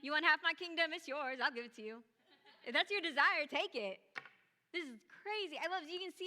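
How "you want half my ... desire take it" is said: king offering his kingdom. Retold or imitated. The king to uninvited Esther, generous loud offer. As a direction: boom out a lavish offer. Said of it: "saw her and is like".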